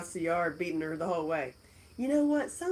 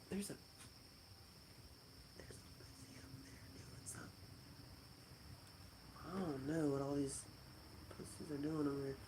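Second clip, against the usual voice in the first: hushed, quick whisper; 3 semitones lower, 16 dB quieter, faster than usual. Real, not acted. Spotting cats outside the window, in a hushed whisper. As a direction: whisper so the cats won't hear.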